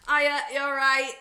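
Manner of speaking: loud, put-on northern English greeting; stronger northern English accent. Customer greeting restaurant staff, exaggerated northern English accent. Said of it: "put on a stronger northern accent".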